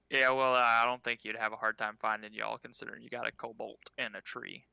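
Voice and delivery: innkeep voice. Acted. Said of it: "the innkeep's just like".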